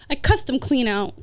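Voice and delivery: normal talking voice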